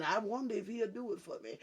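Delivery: quiet, fast, doubtful murmur, mocking; mock doubtful believer. Preacher mimicking a doubter in the pews, soft quick mock mumble. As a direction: toss off a doubter's thought quickly and softly. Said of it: "part of you be saying".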